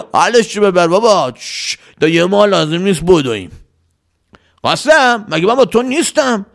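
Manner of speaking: bossy leader, demanding and impatient; a lightly voiced bandit boss interrogating; gruff bandit chief. A narrator's reading. Salim orders Qasem.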